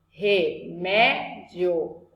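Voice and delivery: Remédio drawn out very slowly with MÉ leaned on; teacher spelling out a paroxítona slowly; slow stress demo. Shown as a demonstration. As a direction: stretch Remédio, lean on the middle MÉ. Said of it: "Penúltima".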